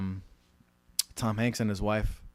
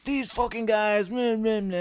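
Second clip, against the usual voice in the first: high, slow mocking whine; whining imitation; 12 semitones higher, 5 dB louder, slower than usual. Director griping on the phone about the producers, in a high mocking whine. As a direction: mimic a spoiled guy whining, pitched up. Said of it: "and he's whining".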